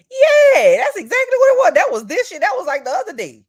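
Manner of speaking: high, loud squealing agreement; gushing, thrilled high-pitched yes; excited squeal. Real, not acted. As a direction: shriek it out in delight. Excited reaction to a comment.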